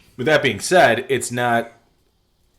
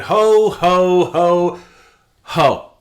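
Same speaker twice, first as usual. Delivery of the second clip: jolly ho ho ho; 11 semitones higher, 9 dB louder, much slower than usual. Pretend. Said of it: "like you're Santa".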